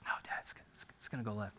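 very soft, low protest; quiet greenside objection; hushed son. Acted. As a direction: disagree in a near whisper. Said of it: "everyone's quiet".

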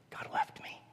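hushed, voiceless whisper; whispering God left me in despair; whispered doubt. Retold or imitated. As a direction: breathe it like a secret fear. Doubter's inner thought.

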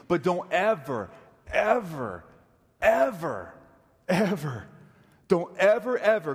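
each "ever" punched separately with pauses between; emphatic warning. Real, not acted. Preacher warning congregation about choosing churches, insistent and emphatic.

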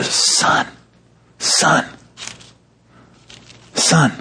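soft whispered repeated call; tender. Shown as a demonstration. Father softly calling his angry son, a whispered tender call. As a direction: whisper it gently, fading back toward voice. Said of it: "like tender".

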